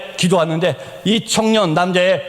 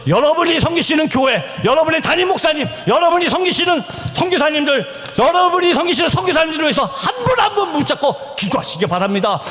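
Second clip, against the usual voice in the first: higher, insistent listing; impassioned preaching; 7 semitones higher than usual. Real, not acted. Preacher urging prayer for church and missionaries, impassioned. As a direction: drive each phrase home as an appeal.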